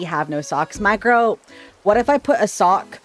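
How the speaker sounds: usual speaking voice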